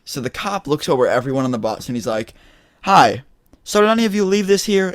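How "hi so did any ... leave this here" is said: high, louder questioning cop; imitated cop. Cop holding up a baggie to the bus, imitated questioning. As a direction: put on a high-pitched officer addressing everyone.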